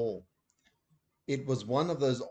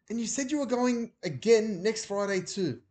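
Ben arguing; 10 semitones higher, 3 dB louder than usual. A narrator's reading. Ben voiced.

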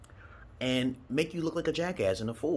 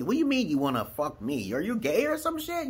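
mock shocked question; 8 semitones higher than usual. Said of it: "I'm like".

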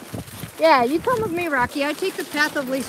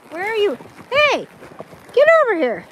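loud, high call across the trail; hollering the dog over; 7 semitones higher, 7 dB louder than usual. Real, not acted. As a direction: shout for the dog to come.

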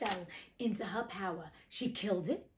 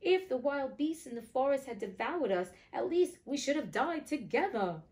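put-on high-pitched plea of a little girl; storybook lament, voice raised in pitch; crying out in lament; 6 semitones higher than usual. A narrator's reading. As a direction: plead to heaven, pitched up. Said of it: "she cried".

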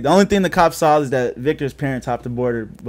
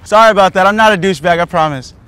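loud, raised-pitch explanation; calling out apology; 5 semitones higher, 10 dB louder than usual. Real, not acted. Man explaining dropped litter to an angry woman, calling out. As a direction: call it over to someone at a distance.

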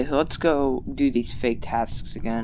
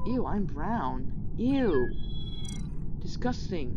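disgusted; 6 semitones higher, 4 dB quieter than usual. Real, not acted. Disgusted at getting brown color.

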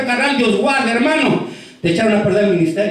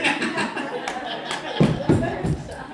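real breathy laugh; 3 semitones higher, 9 dB quieter than usual. Real, not acted. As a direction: laugh at your own skit. Laughs after the skit.

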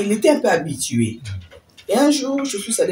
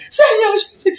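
crying out in fear; 9 dB louder than usual. Retold or imitated. Terrified at the rising lizard.

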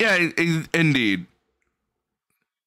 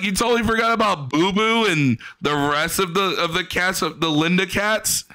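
mocking incredulity; 6 semitones higher than usual. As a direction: rib him for forgetting the cats. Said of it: "You're like".

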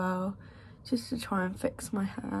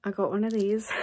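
usual speaking voice

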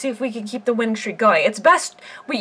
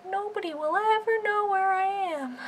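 secretive singsong; 9 semitones higher, 7 dB quieter than usual. Pretend. Giraffe hides in the letters, soft high singsong. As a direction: sing it softly from a hiding place.

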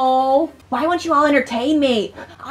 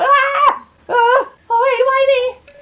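panicked; 9 semitones higher, 4 dB louder, much slower than usual. Real, not acted. Panicked cries.

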